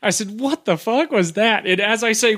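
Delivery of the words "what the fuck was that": retold shock, higher and drawn out slowly; stunned disbelief. Telling how he reacted to the curtain lifting, stunned.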